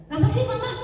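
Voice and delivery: put-on high, whiny teen protesting; whiny daughter. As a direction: play the annoyed daughter talking back. Daughter voiced in mock dialogue.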